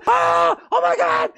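high, hammed-up panicked shriek; mock panic. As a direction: play an office in screaming panic. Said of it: "I could imagine the Hoyoverse, like".

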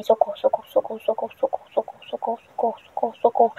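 tiny hushed mock-panic calls; hushed cries for help. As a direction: breathe the pleas out while hiding from the monster. Something chasing her in game.

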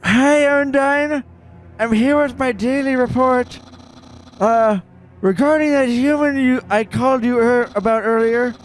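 high-pitched little monster reporting to his boss; high squeaky character voice. Pretend. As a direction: squeak it out nervously, like a timid minion. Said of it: "this voice makes me so out of breath".

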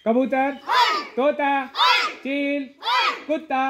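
loud, high game calls to the class; calling out. Real, not acted. Teacher calls out game words.

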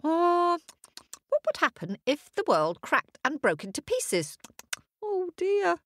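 high fretful little hare voice; worried hare. A narrator's reading. Storyteller voicing the hare's anxious thoughts, high and fretful. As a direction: fret high like a nervous hare. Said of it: "his thinking was going like this".

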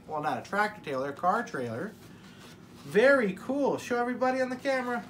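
ordinary talk, nothing marked

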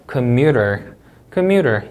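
commuter, leaning on MU, then falling; stressed syllable in commuter. Shown as a demonstration. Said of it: "이음절 강세".

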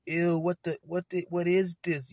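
high, loud grossed-out ew; disgusted shock. Retold or imitated. A boy finding the mess on himself, shrieking in disgust. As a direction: recoil in disgust, voice jumping up.

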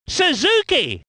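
surname lifted and exclaimed; excited name call. Acted. Commentator naming Suzuki, exclaimed call.

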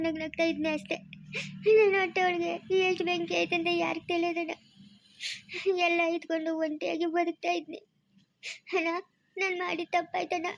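soft tearful outpouring; weeping. Acted. Sister telling her brother of her lonely life, weeping softly. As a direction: pour out loneliness while crying. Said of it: "ಅಳ್ಬೇಡ".

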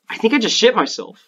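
mock shocked gamer. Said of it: "He's like".

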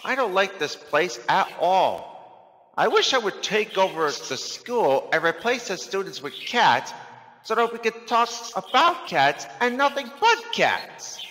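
cat complaining to himself. A narrator's reading. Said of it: "Montana said to himself".

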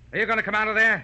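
loud, high menacing yell; threatening shout. Acted. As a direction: roar it at her hiding place. Killer yells at hiding woman.

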